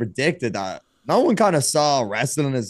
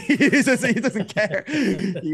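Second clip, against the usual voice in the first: higher voice, talking through chuckles; real laughing; 7 semitones higher, much slower than usual. Real, not acted. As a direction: laugh fondly while describing a friend. Amused by colleague's indifference.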